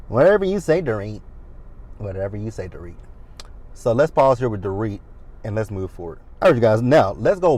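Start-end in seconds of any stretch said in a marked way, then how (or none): 0.1-1.2 high-pitched mock agreement; sarcastic dismissal. Real, not acted. Sarcastic dismissal, first take raised. 2.0-2.9 low, soft sarcastic aside; muttered dismissal. Real, not acted. Same dismissal muttered as second take.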